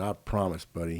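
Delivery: low, soft promise; hushed fatherly assurance; quiet reassurance. Retold or imitated. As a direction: lie softly to a trusting child. Dad quietly lying to trusting son.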